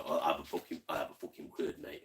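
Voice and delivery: low, quiet Cockney hard-man threat. Pretend. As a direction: put on a Cockney voice, low and menacing in jest. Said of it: "I've got me Cockney voice".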